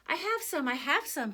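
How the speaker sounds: a light, eager villager's call, starting high; a touch of excited crying out. A narrator's reading. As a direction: call out that you have some, lightly voiced. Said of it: "people cried out".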